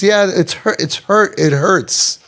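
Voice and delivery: usual speaking voice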